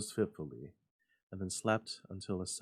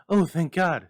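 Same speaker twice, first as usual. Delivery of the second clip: relieved cry; 9 semitones higher, 15 dB louder than usual. A narrator's reading. Mother relieved, high.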